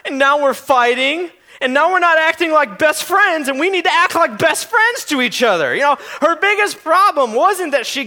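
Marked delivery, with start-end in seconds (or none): 0.1-5.7 put-on higher-pitched child's protest; imitating upset small daughter. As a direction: plead like a hurt four-year-old, mildly acted. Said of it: "And she goes".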